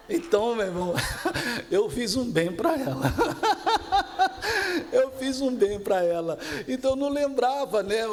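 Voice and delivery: talking through real laughter; cracking up at his own joke; laughing. Real, not acted. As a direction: laugh while you tell it, high and loud. Pastor laughing at own story.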